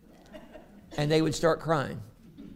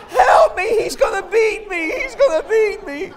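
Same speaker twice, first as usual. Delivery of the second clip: mock toddler wail, much higher and louder; child screaming; 12 dB louder than usual. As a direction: shriek it like a squirming kid. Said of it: "pulling out like this and saying".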